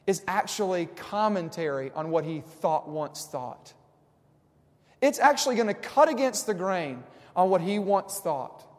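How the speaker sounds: loud, rising sermon voice, driving each point home; preaching at full force. Acted. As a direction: pastor pressing the psalm on his congregation, preaching hard.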